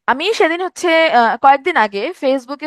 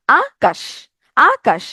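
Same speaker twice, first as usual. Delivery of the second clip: "Akash" split in two, আ rising, কাশ falling; broken into syllables; much slower than usual. Shown as a demonstration. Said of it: "ভেঙে ভেঙে উচ্চারণ করলাম".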